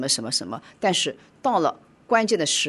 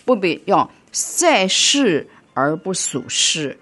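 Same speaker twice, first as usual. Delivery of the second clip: full-force preaching; 5 semitones higher, 6 dB louder than usual. Acted. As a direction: preach it out to the congregation, hammering each line. Preaching to congregation. (大声激昂的讲道)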